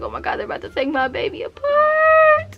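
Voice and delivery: very high mock-crying wail; hammed-up panic dissolving into a squeal; nervous wail. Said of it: "so I'm getting nervous".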